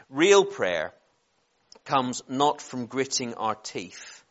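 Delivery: slow, deliberate quote reading with long pauses; measured preacher's recitation, phrase by phrase. Real, not acted. Reads out a quotation, repeated.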